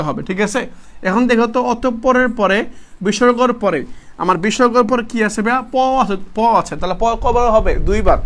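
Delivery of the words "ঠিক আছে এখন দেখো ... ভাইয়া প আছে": usual speaking voice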